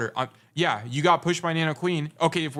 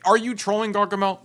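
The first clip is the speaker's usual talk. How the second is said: higher, louder teasing jab; mock accusing call-out; 7 semitones higher, 6 dB louder than usual. Real, not acted. Coach teasing the player about trolling, loud mock accusation. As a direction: call him out like he's throwing.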